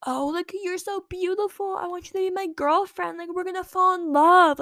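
high-pitched gushing imitation of a boy in love; mock lovestruck boy. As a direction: gush it high, mocking his crush. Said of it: "But he was like".

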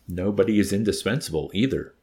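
plain reading voice

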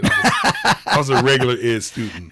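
holding back a laugh; laughing through the words; trying not to laugh. Real, not acted. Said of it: "So I'm trying not to laugh".

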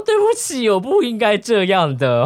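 mock apology. Friend apologizes.

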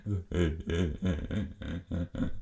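low braying hee-haw laugh; imitated donkey laugh. Shown as a demonstration. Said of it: "die so lachen?". (tiefes Esel-Lachen nachgemacht)

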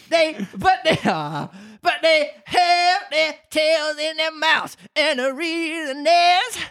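very high, slow creature voice; squeaky and drawn out; Mock Turtle. A narrator's reading. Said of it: "the Mock Turtle yawned".

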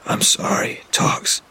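hoarse, whispered apology; a voice torn raw; wrecked voice. Acted. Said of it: "my voice a wreck".

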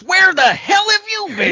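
very high, loud shouted mascot voice; shrieking cartoon sidekick yell. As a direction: yell it like an angry costumed mascot. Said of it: "welcomed by a Booster who says".

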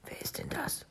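hushed, whispery amazement; breath of astonishment; stunned. Acted. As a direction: ask it very softly, taken aback. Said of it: "fragte er verblüfft".

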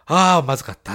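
loud, acted-out sigh of disgust; retold exclamation. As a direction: blurt it out, reliving the bad taste. Retold relief after gulping tea. (大げさに再現する「まずかった」)

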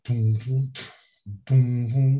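imitating a porno soundtrack beat; mock music beat. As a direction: hum the cheesy intro music. Imitating porn intro music.

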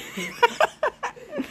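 high squealing laugh. Real, not acted. Laughs mid sentence.

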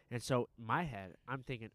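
ordinary talk

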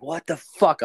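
high-pitched startled exclamation; retold alarm. Telling friends the tap came back on by itself, retold alarm. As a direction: jump up in pitch, acting out being baffled. Retelling finding the water running.

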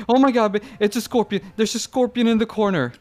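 high, loud mock alarm. Retelling wife spotting a scorpion in the corner, alarmed. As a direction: imitate a panicked cry of discovery.

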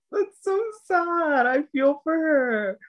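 hammed-up heartbroken sigh of pity; mock sympathy. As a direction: coo over her like a sad puppy. Retold own sympathetic reaction.

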